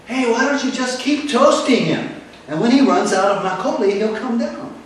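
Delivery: high, loud joking shout; yell from crowd. Retold or imitated. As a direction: heckle the police from the crowd. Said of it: "Somebody yelled out".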